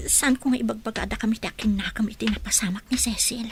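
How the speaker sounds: anxious unvoiced whisper. Woman whispering whether to tell Nana, anxious. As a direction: breathe the doubt inwardly.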